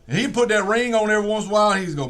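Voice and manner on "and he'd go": ordinary delivery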